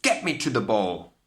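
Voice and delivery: loud angry demand; shouted demand. A narrator's reading. Cindy demands to go to the ball, shouting. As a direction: pound the wall and demand it. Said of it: "and shouted".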